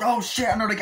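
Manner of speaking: high, loud startled cry; mock panic in a club shootout; panicked yelp. Ambush erupts in the nightclub.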